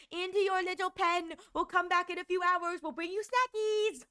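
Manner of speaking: high, teasing mock jailer; mock captor voice. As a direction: tease the prisoner like a pet. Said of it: "It's like".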